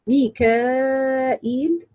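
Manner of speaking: "ميكائيل" very slowly, long held aa before the hamza; connected madd. Shown as a demonstration. Teacher demonstrates the reading, "ميكائيل" with long madd. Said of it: "مع مد متصل".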